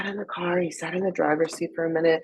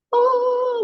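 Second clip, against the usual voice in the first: awed gasping oh; 7 dB louder than usual. Retold or imitated. Awe at a still moment.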